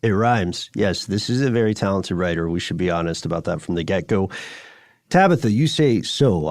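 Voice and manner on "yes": ordinary talk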